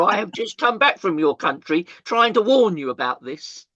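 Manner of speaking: indignant. Retold or imitated. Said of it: "I got indignant. I got annoyed".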